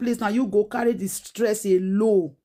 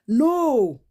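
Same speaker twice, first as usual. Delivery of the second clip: normal talking voice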